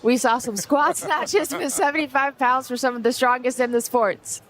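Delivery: giggling through the words; laughing while recalling. Real, not acted. Co-host laughing, mock old-timer voice.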